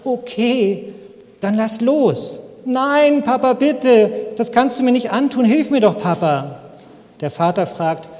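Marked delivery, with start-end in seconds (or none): 0.0-2.4 put-on higher, quavering scared child, slow and hesitant; trembling voice. Boy answers father from the tree, higher trembling voice. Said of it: "sagt mit vibrierender Stimme". 2.7-6.6 put-on pleading child.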